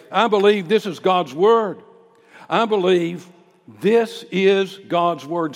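loud, high preacher's confession of faith; bold public declaration. Acted. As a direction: declare it boldly in the public square. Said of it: "say it just like that".

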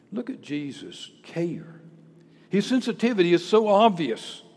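ordinary talk

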